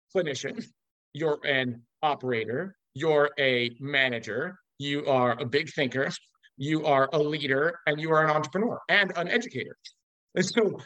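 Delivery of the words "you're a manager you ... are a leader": ordinary delivery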